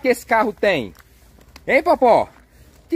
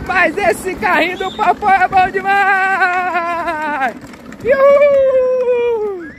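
excited shouting; 5 semitones higher, much slower than usual. Real, not acted. Dad hyping the toy truck for his girls, high excited shouts. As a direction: whoop it up like the game is thrilling.

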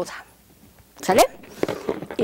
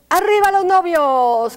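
loud, high cheer for the couple; shouted cheer; 6 semitones higher, 10 dB louder than usual. Shown as a demonstration. Teacher leads the class's cheer for the couple, loud and high. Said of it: "todos gritamos".

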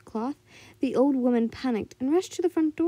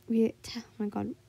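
ordinary delivery, nothing marked

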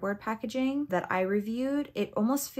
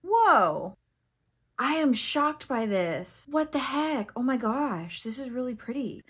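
loud "whoa", then higher, drawn-out shock; excited amazement; 5 semitones higher, 6 dB louder, slower than usual. Real, not acted. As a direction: gush at a thrilling surprise. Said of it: "I love it when I get excited".

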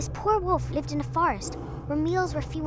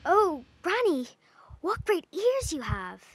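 higher, slow and wondering; wondering little girl; 6 semitones higher, slower than usual. A narrator's reading. Girl to disguised wolf, slow and wondering. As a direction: marvel slowly at granny's ears.

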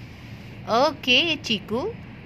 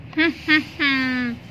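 villain chuckle. Acted. Cat laughs at trapped duck.

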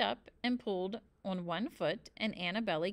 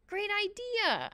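high, slow squeak; 13 semitones higher, 3 dB louder, slower than usual. A narrator's reading. Boy hanging in the tree agrees, squeaking. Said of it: "I squeaked".

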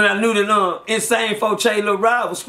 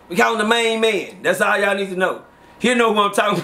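usual speaking voice

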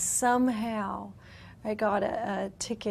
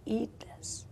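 soft whispered order; whispered command; 11 dB quieter than usual. Retold or imitated. God handing her his flesh, whispering. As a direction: breathe the command.

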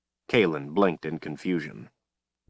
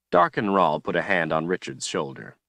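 plain reading voice

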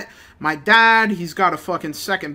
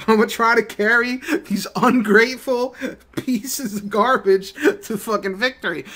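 laughing; 5 semitones higher than usual. Real, not acted. Son vowing to carry his ungrateful family, laughing.

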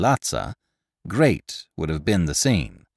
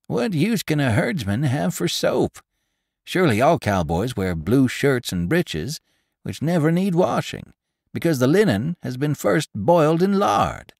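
higher, showing off his learning; know-it-all; 9 semitones higher than usual. Acted. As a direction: lecture the room to look clever. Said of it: "who desired to display his knowledge".

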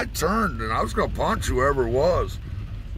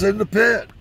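higher, loud excited reply; sweaty friend shouting back; Bobby's answer; 12 semitones higher, 7 dB louder than usual. Retold or imitated. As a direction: shout the answer over music. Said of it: "he goes".

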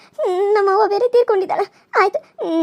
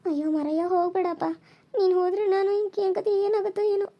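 much softer hopeless plea; despairing whisper; 8 dB quieter than usual. Acted. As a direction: breathe it out in despair. Girl's despairing aside. (ಹತಾಶೆಯ ಪಿಸುಮಾತು)

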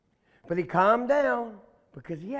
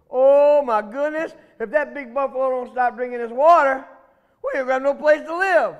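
loud, higher-pitched worry; alarmed catfish; 4 semitones higher, 7 dB louder than usual. Acted. Miko watches the water level drop, alarmed. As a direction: fret loudly as the catfish.

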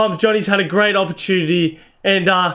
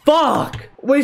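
high, sudden curse; frustrated outburst; 7 semitones higher than usual. Real, not acted. Gamer who just lost his victory, frustrated outburst. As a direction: burst out with one frustrated swear. Concedes at end of match.

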